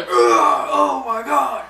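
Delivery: high, loud, slow howl of fake pain; mock pain cry. Boss hit with a crutch, hammed-up pain howl. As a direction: ham up getting whacked.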